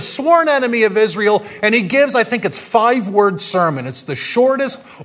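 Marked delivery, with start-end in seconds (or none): none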